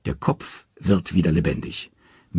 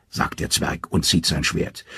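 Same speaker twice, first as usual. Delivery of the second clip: ordinary delivery, nothing marked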